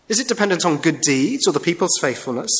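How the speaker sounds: usual speaking voice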